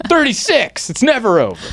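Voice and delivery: ordinary talk, nothing marked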